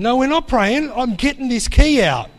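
half-comic snap back; stubborn refusal. Retold or imitated. Husband refuses his wife's suggestion to pray, stubborn and loud.

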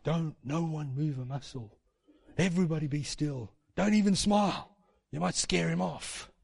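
soft, cautious, held-back voice; comically careful hush; mock hushed warning. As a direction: warn them quietly so nothing gets startled. Mocks churchgoers afraid to move.

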